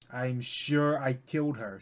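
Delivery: slow emphatic conviction; slow insistent take. Acted. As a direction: lean into each word, insisting. Repeated insistence.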